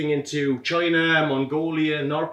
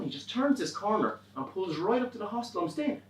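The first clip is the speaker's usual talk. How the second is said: ordinary talk, nothing marked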